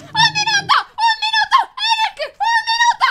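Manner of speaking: very high-pitched, elated squeal; excited calling out. Real, not acted. Girlfriend announces the minute to Eric, excited squeal.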